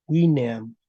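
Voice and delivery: "Wineam" opening on a w glide; Latin pronunciation. Shown as a demonstration. Teacher introduces the Latin word, Latin pronunciation. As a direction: say "Wineam" as wi-ne-am, final m sounded. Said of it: "P cero sería wi".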